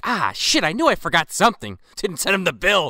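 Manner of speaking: a lawyer who forgot to bill; lawyer remembering. Retold or imitated. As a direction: Sam realizes he never sent the bill. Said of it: "and he's like".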